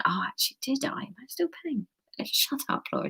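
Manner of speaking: quiet whispered aside. Real, not acted. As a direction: drop to a whisper. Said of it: "aside whispered mid reading".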